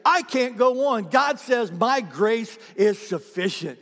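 loud, high, driving call-and-response; preaching at full force. Acted. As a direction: preach it hard, building each promise.

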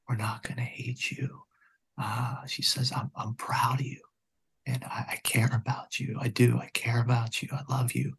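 soft, whispered loving words; retold woman whispering love to the gunman; tender reassurance. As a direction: hush it like calming someone on the edge.

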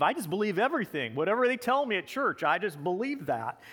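naive Southern churchgoer, lilting up high then falling; Southern accent. Acted. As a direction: play a gullible believer with a Southern twang. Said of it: "go into a Southern accent".

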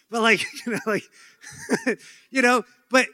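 laughing through the words; amused laughter mid-sentence. Real, not acted. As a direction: break into a real laugh. Laughing at own point.

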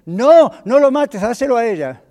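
high, loud cry of protest; desperate plea. Acted. Mother begging Solomon to spare her son, desperate.

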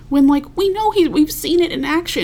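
voicing indignant objectors; indignant rebuttal. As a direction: argue back in mock outrage, pitch lifted. Acting out Democrats' indignant rebuttal.

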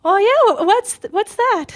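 high, loud mock-mom eagerness; excited mother. Mom asking about the zoo job, high excited imitation. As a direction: imitate a fascinated mom, voice shooting up. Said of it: "my mom's really excited about everything I do".

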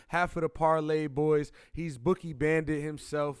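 loud podcast host intro. Acted. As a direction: announce it like opening the show. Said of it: "I'm using my intro voice".

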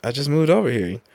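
a prankster acting baffled about the neighborhood; mock innocent surprise. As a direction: play the clueless newcomer, pitch up. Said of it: "He was like".

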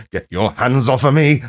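mock hiss. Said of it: "and hiss like a bad cat".

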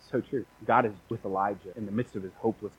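ordinary talk, nothing marked

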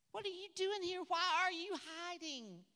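high, loud challenge from God; God's voice, loud rebuke. Acted. God rebukes the hiding prophet, loud and high. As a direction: demand it from on high.

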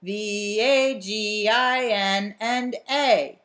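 letters drawn out slowly; drawn-out playful spelling bit. Shown as a demonstration.

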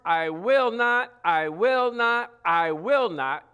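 will not, will not, will not, each slow and firm; preacher stressing God's promise to the congregation, slow and firm; repeated for emphasis. Shown as a demonstration. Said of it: "He did it three times for emphasis".

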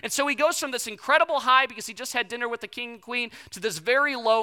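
ordinary delivery

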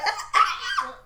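very high-pitched mock gasping cries; short squeaks of exaggerated disbelief; high, comic shocked yelps; stunned squawk. Imitates own stunned reaction.

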